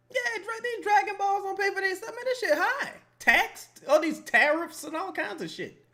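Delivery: hammed-up griping about the economy; mock whiny complaint. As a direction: whine about prices like a cartoon. Complaining about prices in character.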